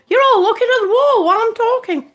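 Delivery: high, loud, quick self-interruption; exclaiming interruption. Real, not acted. Streamer noticing viewers see only wall, loud exclamation. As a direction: burst out, breaking off your own story. Catches herself showing the wall.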